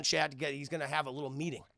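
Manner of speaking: normal talking voice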